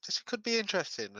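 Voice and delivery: light up at the idea; intrigued. Acted. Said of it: "half, like".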